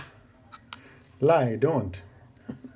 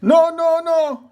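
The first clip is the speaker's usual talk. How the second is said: shouting; 3 semitones higher, 7 dB louder than usual. Acted. Crowd stopping the mob justice.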